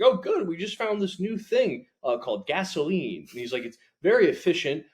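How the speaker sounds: high, loud, breezy pitchman voice; cheery old-timey salesman. Retold or imitated. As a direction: sell danger like good news. Voiced 1950s oil executive.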